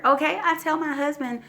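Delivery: ordinary talk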